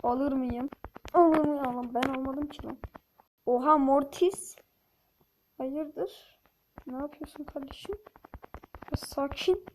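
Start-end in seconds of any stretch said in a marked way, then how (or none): none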